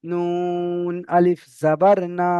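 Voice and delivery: নুন আলিফ জাবার না, very slow with না drawn out; long না reading. Shown as a demonstration. Teacher to students, drawing out the vowel. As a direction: stretch না one alif. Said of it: "এখানে এক আলিফ টান হবে".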